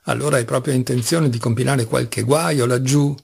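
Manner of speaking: ordinary delivery, nothing marked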